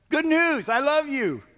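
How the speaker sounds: high and loud, building to a cry; preaching at full force. Acted. Pastor proclaiming God's love to the congregation, high and loud.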